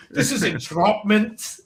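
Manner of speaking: slow, lilting mock-Scottish star; Sean Connery. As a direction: stretch it out in a hammy Scottish actor voice. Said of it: "I can voice Sean".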